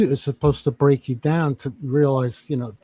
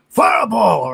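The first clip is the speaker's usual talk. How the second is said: barked, higher and louder, like a drill sergeant; shouted name; 6 semitones higher, 6 dB louder, slower than usual. Retold or imitated. Drill sergeant yelling for the recruit Fireball, louder, higher. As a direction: yell "Fireball" across the yard.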